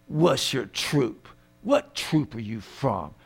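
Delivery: low, slow, hushed questioning; enemy interrogator. Acted. Enemy grilling a captured soldier, low slow interrogator voice. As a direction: menace the prisoner quietly, slowly.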